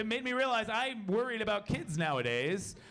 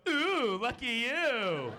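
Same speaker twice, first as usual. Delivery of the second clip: high, drawn-out mock gushing; gushing congratulation; 5 semitones higher, 4 dB louder, much slower than usual. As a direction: coo it up high like an impressed mint pusher. Mocked Tic Tac guy congratulating.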